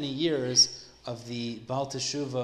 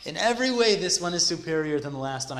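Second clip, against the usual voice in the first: excited report; 4 semitones higher, 6 dB louder than usual. Retold or imitated. Man thrilled with new match.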